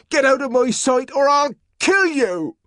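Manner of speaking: high, loud threat; furious threat. A narrator's reading. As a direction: scream the threat over her. John threatening.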